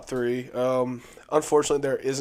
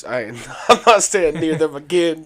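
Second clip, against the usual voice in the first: laughing; 4 semitones higher, 8 dB louder than usual. Real, not acted. Laughing about cigarette smell.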